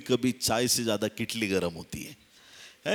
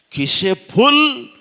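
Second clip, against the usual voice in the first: high, loud, stretched-out cry; slow, ringing, swaggering delivery; exuberant boast; 14 semitones higher, 13 dB louder, much slower than usual. Acted. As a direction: crow it like someone flush with money. Mimicking confidence with full pockets.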